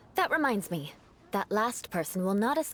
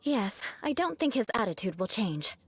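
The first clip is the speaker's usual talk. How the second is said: ordinary delivery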